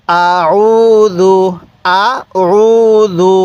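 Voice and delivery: আউযু drawn out very slowly; long chanted আউযু; slow recitation. Shown as a demonstration. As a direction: stretch আউযু long and even. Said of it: "এই হরফগুলোর উচ্চারণ কিভাবে পড়তে হবে?".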